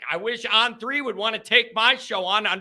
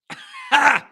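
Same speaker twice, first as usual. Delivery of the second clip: laughing; 6 semitones higher, 4 dB louder than usual. Real, not acted. Laughing at choking fit.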